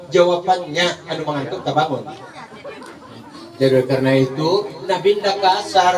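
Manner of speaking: ordinary talk, nothing marked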